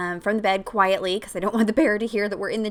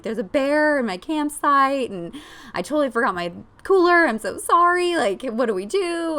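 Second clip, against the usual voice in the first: high, loud, teary panic, slowing on the bear; retold panic; 6 semitones higher, 4 dB louder than usual. Mom begging a stranger for help, near tears and panicking. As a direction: replay the frantic plea, near tears. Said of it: "crying and freaking out".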